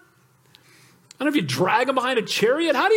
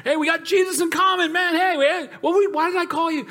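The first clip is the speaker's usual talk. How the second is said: excited phone chat; 8 semitones higher than usual. Retold or imitated. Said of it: "We start talking".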